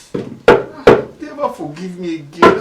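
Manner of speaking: loud, slow, anguished cry to himself; loud anguished plea. Acted. Father crying out his regret alone. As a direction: bellow the regret slowly.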